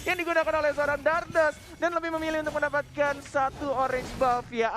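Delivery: rising hype call; excited commentary. Real, not acted. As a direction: get fired up on the play. Caster hyping a play.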